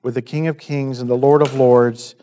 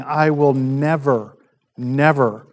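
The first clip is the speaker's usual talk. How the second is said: "I will never, never" said slowly, leaning hard on each "never"; slow, weighty stress on "never" both times; emphatic never; slower than usual. Shown as a demonstration. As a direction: stretch out "never, never" like a firm vow. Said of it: "He's really saying".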